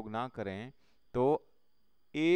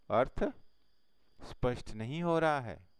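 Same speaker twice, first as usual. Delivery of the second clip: normal talking voice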